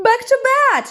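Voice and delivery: high, loud storybook yell; excited yell. A narrator's reading. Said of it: "yelled Ted".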